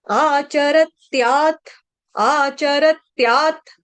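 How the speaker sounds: आचरत्यात् slow with the half त joined to य. Shown as a demonstration. Teacher drilling the half-t in आचरत्यात्. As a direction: say आचरत्यात् slowly, clusters tight. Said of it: "आधा त".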